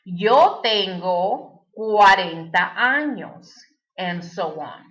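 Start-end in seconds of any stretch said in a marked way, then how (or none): 0.0-3.5 slow, carefully spaced Spanish sentence; slow teacher's model answer in Spanish. Shown as a demonstration. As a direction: say the sample answer slowly and clearly.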